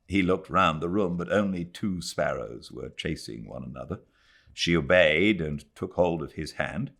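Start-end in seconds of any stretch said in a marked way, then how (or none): none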